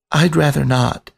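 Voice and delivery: high, small, earnest voice explaining his limit; a nervous young rat begging the photographer and panther; timid rat pleading. A narrator's reading. Biscuit refusing.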